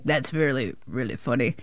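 low, softened star impression; Adam Sandler. Director mimics Sandler finding her funny, low impression. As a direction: drop low and soft, imitating his approval. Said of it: "hear Adam Sandler laugh and be like".

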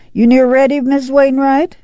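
high, sustained neighborly call. A narrator's reading. Ma checks on the neighbor down the car, calling out. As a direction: call out a question to the next family. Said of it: "she called".

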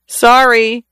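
high, put-on little apology; hardly able to finish. A narrator's reading. Isaac mimicking Uncle Will for Corey, high mock apology. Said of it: "Isaac could hardly finish".

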